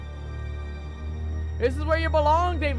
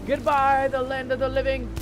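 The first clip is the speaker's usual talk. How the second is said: dramatic farewell; 5 semitones higher, 6 dB louder than usual. Acted. Dramatic farewell entering portal.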